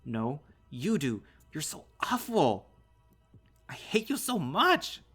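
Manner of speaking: high, very loud, drawn-out anguished screams; screaming meltdown. A narrator's reading. Son's screaming breakdown at father.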